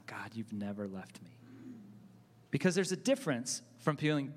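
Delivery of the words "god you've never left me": softly whispered, inward prayer to God. Retold or imitated. Pastor recalling praying to God in a whisper. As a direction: breathe it like a private prayer. Private prayer remembered while singing.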